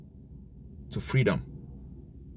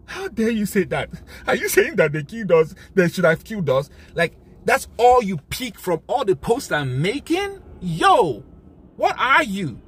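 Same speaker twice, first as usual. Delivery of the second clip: high, loud, furious outburst; angry; 6 semitones higher, 4 dB louder than usual. Real, not acted. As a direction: scold them in real anger, pitched up high. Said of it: "But I'm angry, very angry".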